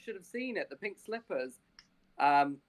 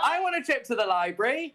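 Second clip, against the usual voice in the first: put-on eager kid; 6 semitones higher, 7 dB louder than usual. Childlike eager player voice.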